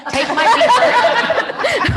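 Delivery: high, loud, slow, laughing; laughing repeat. Real, not acted. Agent repeating Marty's beeper offer while cracking up. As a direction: savor the absurd memory through laughter.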